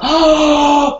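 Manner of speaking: a loud shocked "oh"; shocked gasp oh. Retold or imitated. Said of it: "And he just went".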